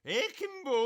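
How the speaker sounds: put-on high, loud Scottish accent. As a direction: bark it like a Scotsman. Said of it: "I need to do it in a Scottish accent".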